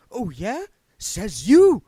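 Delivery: a jeering voice from the crowd; taunting ghost. Acted. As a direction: another ghost heckling the spirit guide.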